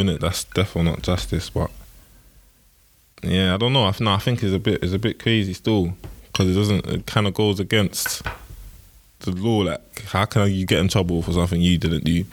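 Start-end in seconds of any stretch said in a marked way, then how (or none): none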